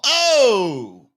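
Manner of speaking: a loud, high burst of delight at his own joke; excited oh. Real, not acted. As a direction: punch out the triumphant oh. Reacts to his own pun.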